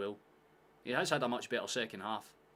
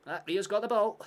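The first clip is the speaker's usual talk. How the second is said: high, loud Scottish shout; 11 semitones higher, 12 dB louder than usual. Acted. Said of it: "That's like the accent everyone thinks".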